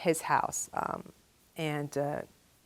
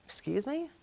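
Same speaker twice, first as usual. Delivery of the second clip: incredulous question; 8 semitones higher, 8 dB quieter than usual. Retold or imitated. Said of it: "I go".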